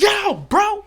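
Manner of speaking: sudden high startled yelp, mock fright. Startled by a groundskeeper, a high startled yelp. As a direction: jump and yelp it, caught off guard. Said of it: "I was like".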